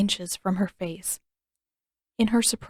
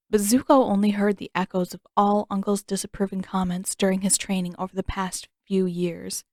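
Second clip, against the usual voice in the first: ordinary delivery, nothing marked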